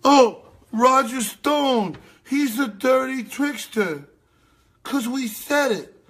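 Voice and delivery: high, slow mockery; high slow sneering mimicry; mocking drawl. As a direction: drag it out sneering. Mocking the host.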